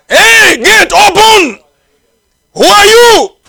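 very high, loud, slow shouted challenge; a guard barking at a stranger at the gate; challenging gatekeeper. Acted.

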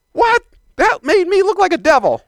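high, loud startled cry; mock outrage at the mirror; shocked surprise. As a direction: jump up in pitch, aghast. Voiced shocked reaction to mirror.

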